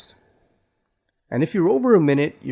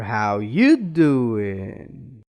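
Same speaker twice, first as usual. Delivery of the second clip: slow, lift the pitch sharply on "you", stretch "doing"; stress on YOU; 5 semitones lower, much slower than usual. Shown as a demonstration. Teacher showing ways to stress, pitch jump on "you" and long "doing". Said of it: "you can stress by changing the pitch".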